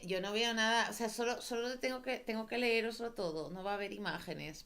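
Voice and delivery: usual speaking voice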